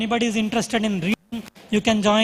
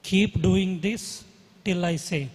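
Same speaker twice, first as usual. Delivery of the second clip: guided meditation; slower than usual. Acted. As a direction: guide the breathing calmly, slowly. Leads a breathing meditation.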